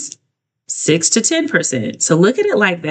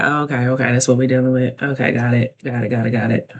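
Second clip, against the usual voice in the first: low murmured "got it, got it"; muttering to self; 5 semitones lower, faster than usual. Retold or imitated. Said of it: "you're like".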